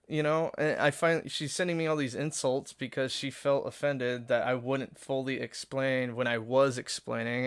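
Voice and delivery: usual speaking voice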